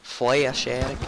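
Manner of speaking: "Flesh addict" slowly, flesh as flay-esh; mimicked pronunciation. Gamer copies friend's word slowly, mimicking "Flesh addict". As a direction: draw out "Flesh" into two syllables before "addict". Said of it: "How'd you say?".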